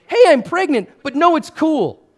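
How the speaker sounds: mock casual. A fiance breezily announcing a pregnancy, mock casual. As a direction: play it off as no big deal, mocking. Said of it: "your betrothed comes to you and goes".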